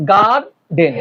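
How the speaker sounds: গার্ডেন as two drawn-out beats, gar, den; syllable by syllable. Shown as a demonstration. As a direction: count out গার্ডেন one syllable at a time. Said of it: "এখানে syllable আছে কয়টা".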